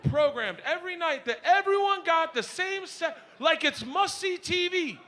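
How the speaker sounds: high-pitched, drawn-out disbelief; incredulous rant. Acted. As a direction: rant in exasperated amazement. Exasperated rant at daughter's belief.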